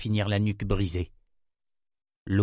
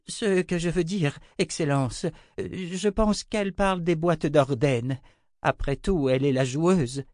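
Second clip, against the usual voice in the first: high, hesitant deferential woman; high-voiced nervous justification; anxious servant; 8 semitones higher than usual. A narrator's reading. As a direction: explain yourself to your master, pitched high. Servant explains nervously to emperor.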